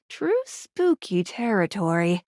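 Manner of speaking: low, slow spooky delivery; ominous and drawn out; playfully spooky. Acted. Playfully eerie on spooky words.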